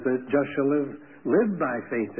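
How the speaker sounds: "live" weighted and said again, "live, live by faith"; stress on live. Shown as a demonstration. Preacher showing Galatians' emphasis, "live" stressed. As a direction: lean on "live" and repeat it. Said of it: "he put the emphasis on the life".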